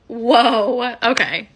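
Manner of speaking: loud, startled reaction; taken aback. Retold or imitated. Wife reacting to drunk husband's demand about cousin, taken aback. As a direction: burst out loudly, taken aback.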